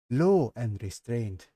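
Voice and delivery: plain reading voice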